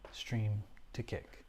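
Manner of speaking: slow, resigned whisper; whispered aside. Real, not acted. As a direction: breathe it out as a defeated aside. Frustrated aside about streaming bug.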